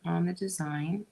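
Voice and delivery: talking while chewing gum; words round a wad of gum. Real, not acted. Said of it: "talk and chew gum at the same time".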